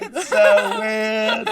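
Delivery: laughing echo, high and loud. Retold or imitated. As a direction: repeat it through giggles. Echoes the Irish quote laughing.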